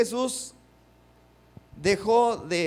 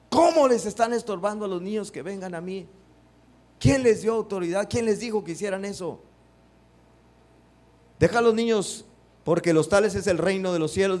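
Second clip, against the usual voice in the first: loud, higher scolding questions; indignant rebuke; 4 semitones higher, 4 dB louder than usual. Shown as a demonstration. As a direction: rebuke the disciples, raised and indignant. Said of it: "Así me lo imagino". (reprensión indignada, alta y fuerte)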